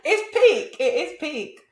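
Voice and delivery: high, loud, through real laughter; laughing. Real, not acted. Co-host cracking up at own callous joke, laughing. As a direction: laugh your way through the words.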